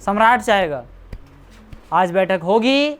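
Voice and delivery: ordinary talk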